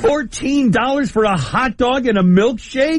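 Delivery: high, slow, stretched-out disbelief at the price; shocked disbelief. Real, not acted. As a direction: drag out fourteen in shock.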